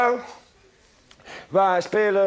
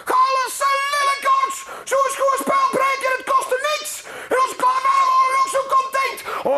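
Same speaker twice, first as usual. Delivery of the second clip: schelle, veel hogere vrouwenstemmen die roepen; schreeuwende moeders. Acted. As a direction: krijs het als boze moeders vanaf het balkon. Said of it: "die riepen van bovenuit de balkons". (moeders schreeuwen boos van de balkons naar de jongen)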